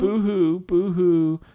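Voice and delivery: loud, high mock crying; fake sobbing. Finn faking tears for Ice King, fake sobbing. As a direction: wail boo-hoo with no real grief. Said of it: "fakely, fake sobbing".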